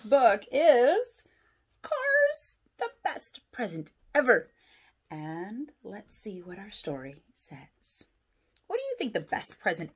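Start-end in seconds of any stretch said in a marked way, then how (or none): 1.8-4.5 high, bright announcing of the title; title announcement. Acted. 5.1-8.0 hushed storytime whisper. Real, not acted. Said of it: "whispered aside".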